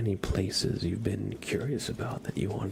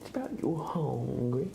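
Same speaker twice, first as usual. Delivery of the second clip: high, drawn-out rising question; cat-teasing singsong; 5 semitones higher, slower than usual. Real, not acted. Owner teasing cat about food, in high drawn-out singsong. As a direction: stretch it out in a high singsong, swooping up at the end. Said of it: "I always say it like that".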